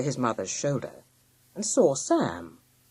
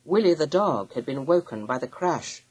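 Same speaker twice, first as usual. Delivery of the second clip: usual speaking voice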